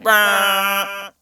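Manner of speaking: a short puffed blast sound; air blast noise. Retold or imitated. Mailman mimicking his air blaster at a dog.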